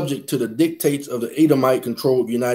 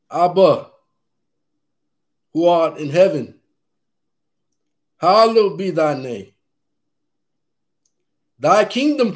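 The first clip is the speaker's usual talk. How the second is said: prayer; 3 semitones higher than usual. Acted. Recites the Lord's Prayer.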